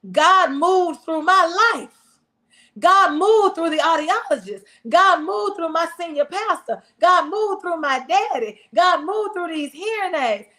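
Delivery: rhythmic pulpit refrain, each line landing on God; preaching. Acted. Preacher testifying to the congregation, rhythmic preaching. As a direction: build the repetition like a sermon.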